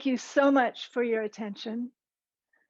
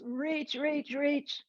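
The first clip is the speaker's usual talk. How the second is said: higher, softer straining voice; straining in a stretch; 4 semitones higher, 4 dB quieter, much slower than usual. Shown as a demonstration. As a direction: urge them on mid-stretch. Stretching hard while leading exercise.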